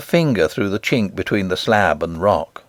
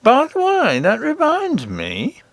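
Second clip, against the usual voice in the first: high, put-on king voice; king remembering something, pitched up; goblin king; 12 semitones higher, slower than usual. A narrator's reading. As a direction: muse in a raised character voice. King suddenly remembers, in character voice.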